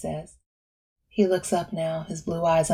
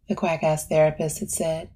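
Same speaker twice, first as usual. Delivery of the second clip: plain reading voice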